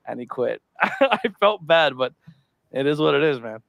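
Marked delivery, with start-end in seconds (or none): none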